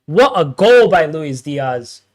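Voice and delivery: slow, loud exclamation; excited. Real, not acted. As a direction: savour the goal. Goal celebration.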